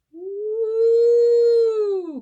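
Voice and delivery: high loud ghostly woo of the wind; howling wind. Acted. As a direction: blow like a winter gale. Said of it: "cried the wind".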